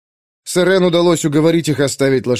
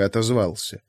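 usual speaking voice

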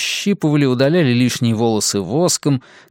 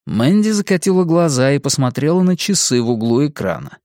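usual speaking voice

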